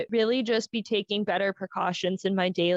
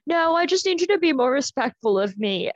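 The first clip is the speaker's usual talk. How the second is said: higher, louder mock-offended doctor; imitated indignant scolding; 5 semitones higher, 5 dB louder than usual. Doctor demanding respect from her patient, imitated indignant scolding.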